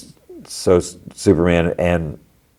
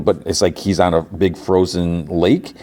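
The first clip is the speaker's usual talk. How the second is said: ordinary talk, nothing marked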